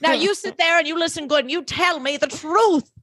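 high, hammed-up stern scolding; stern confronting man. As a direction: lay down the law like a soap opera husband. Rufus confronting Lily, voiced.

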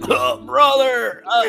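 dying plea. Acted. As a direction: plead weakly from the ground. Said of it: "He's like".

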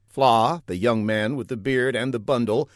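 usual speaking voice

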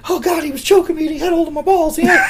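very high, squealy mock complaint; Jimmy Hart. Manager complaining he was choked, comic high-pitched squealing. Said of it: "that high-pitched voice".

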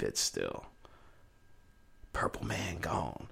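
whisper. Real, not acted. Mourning Prince, voice drops away.

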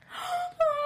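high sudden gasp, caught hurting; sharp gasp of pain. Retold or imitated. Injured actor mid-stunt, sharp high gasp. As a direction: suck in air as the groin pulls.

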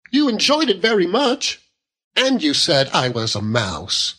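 put-on high-pitched hurt young wife; childlike wife, reproachful. A narrator's reading. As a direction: remind him with wounded petulance. Wife reproaches husband in quarrel.

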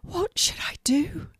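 breathy panicked whisper between gasps; gasping whisper. A narrator's reading. Frightened mermaid whispering to Morgana between gasps. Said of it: "she said between gasps of breaths".

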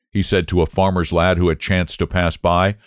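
ordinary delivery, nothing marked

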